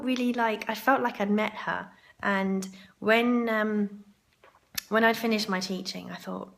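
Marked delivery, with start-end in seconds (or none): none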